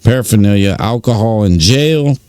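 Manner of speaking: normal talking voice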